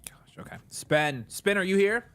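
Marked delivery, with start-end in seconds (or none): 0.1-0.6 whispering. Real, not acted. Aside under breath.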